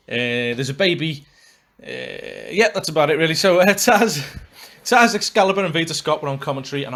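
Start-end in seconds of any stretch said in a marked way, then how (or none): none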